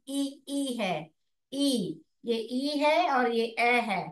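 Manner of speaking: ई drawn out slowly as a long "ee"; long ee name. Shown as a demonstration. Teacher to online class, stretching ई slowly. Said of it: "उसका नाम बोलोगे जो कि ई".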